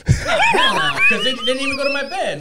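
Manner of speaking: high, loud protest over laughter; shrieked refusal, settling into quick explanation; indignant denial. Real, not acted. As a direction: shout the denial, then rush the reason. Denies teasing suggestion amid laughter.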